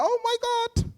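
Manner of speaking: high, soft shocked gasp of words. Retold or imitated. Teacher hearing a pupil's cheek, high shocked squeak. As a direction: squeak it out in disbelief. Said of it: "I was like".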